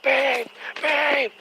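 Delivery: high, loud, panicked calls of her name; retold frantic scream. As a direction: yell for her as if she might be dead. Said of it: "so I start screaming".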